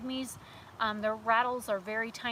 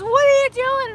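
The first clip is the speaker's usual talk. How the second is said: loud higher startled yell; alarmed dad shout; 13 semitones higher, 15 dB louder than usual. Retold or imitated. Dad seeing her grab the snake, loud alarmed shout. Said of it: "He goes".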